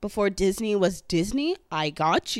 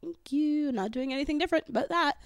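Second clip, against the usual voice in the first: coy delight; 8 semitones higher than usual. Retold or imitated. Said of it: "And I'm like".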